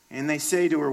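ordinary talk, nothing marked

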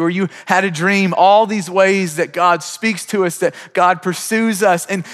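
full-force sermon voice; fervent preaching. Acted. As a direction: preach it hard to the room. Preaching builds again.

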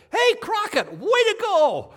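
put-on very high, very loud little-boy cheer; excited boy's shout. Four-year-old boy jumps up in church to cheer Crockett, excited shout. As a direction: yell it out high, stretching the last words.

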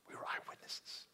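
softly whispered testimony; hushed whisper quoting Peter. Acted. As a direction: breathe it like a secret.

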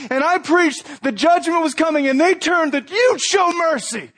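high, heated complaint; mad at God. Retold or imitated. Jonah tells God he is mad, high and heated. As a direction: let the resentment spill out, pitched high. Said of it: "In other words".

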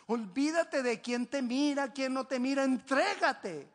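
impassioned preacher's appeal; forceful preaching. Real, not acted. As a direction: urge them on loudly, stretching the key words. Preaches exhortation at full force.

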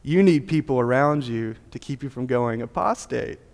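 normal talking voice

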